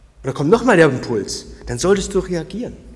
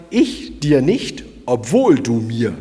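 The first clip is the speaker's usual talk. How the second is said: slow, stressed contrast on nicht and obwohl; emphatic motto; 2 semitones lower, slower than usual. Shown as a demonstration. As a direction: spell out the motto word by word. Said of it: "Ich wiederhole nochmal". (betont: „ich dir NICHT, OBWOHL du mir“)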